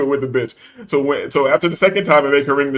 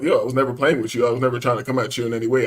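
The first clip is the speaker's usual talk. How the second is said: lower, softer reassurance; very calm; 5 semitones lower, 5 dB quieter than usual. Retold or imitated. Man telling the clerk he meant no harm, calmly. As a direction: deny it quietly, without any heat. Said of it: "I'm very calmly".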